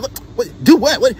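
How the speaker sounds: high, sputtering imitation of an indignant woman; mock mad. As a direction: sputter in pretend outrage, voice jumping up. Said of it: "she'll get mad".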